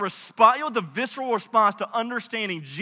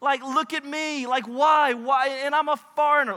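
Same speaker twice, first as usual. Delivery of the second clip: higher-pitched, bewildered protest; baffled disbelief; 4 semitones higher than usual. Acted. Ruth to Boaz over undeserved favor, baffled disbelief. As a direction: plead in puzzlement, pitch lifted. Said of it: "looks at Boaz and goes".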